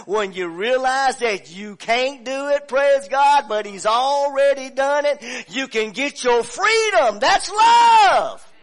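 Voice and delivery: shouted, high climax of the sermon; preaching at full force. Real, not acted. Preacher to congregation, climactic shouting praise. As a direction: build to a roaring shout of praise.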